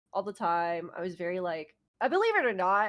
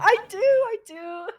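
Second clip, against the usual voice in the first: higher, louder laughing reply; 12 semitones higher, 8 dB louder, slower than usual. Real, not acted. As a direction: laugh through the words. Joking about going outside.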